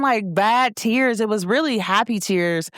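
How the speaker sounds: usual speaking voice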